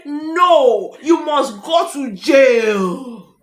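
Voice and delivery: mock shout, slow and stretched out; village people's decree. Host voices village people sending the man to jail, mock shouted decree. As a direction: bellow the verdict, dragging the words. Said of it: "The village people said".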